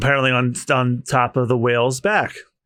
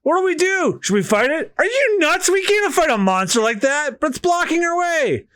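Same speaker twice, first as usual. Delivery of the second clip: panicked crew; 12 semitones higher than usual. Acted.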